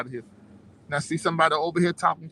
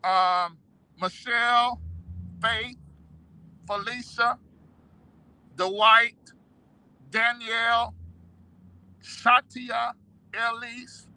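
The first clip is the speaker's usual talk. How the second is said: raised, ringing name call; announcer roll call; 5 semitones higher, slower than usual. Acted. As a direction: call each name out proudly. Reads out subscriber names.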